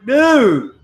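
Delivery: high, loud exasperated cry, retold; acted-out pleading yell; exasperated call. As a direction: shout it to pull a friend into line. Retold scolding of his friend.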